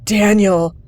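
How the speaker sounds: a loud choked cry of the name. Acted. Kid crying his dead friend's name, choked. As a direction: force the name out through tears. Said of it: "he choked out".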